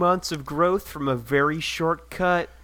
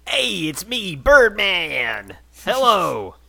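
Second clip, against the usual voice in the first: put-on goofy voice; 6 semitones higher, 8 dB louder than usual. Reading profile in put-on voice.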